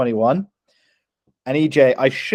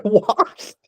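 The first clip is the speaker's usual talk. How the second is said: high, incredulous mock squeal; laughing disbelief; 7 semitones higher than usual. Irish student asked about universities, laughing disbelief. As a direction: retell being flabbergasted, pitched right up. His reaction to absurd university question.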